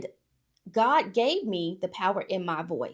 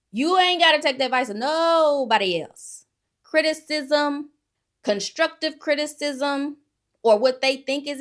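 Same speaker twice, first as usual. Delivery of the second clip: preaching emphasis; 7 semitones higher, 8 dB louder than usual. Real, not acted. Preaching to viewers.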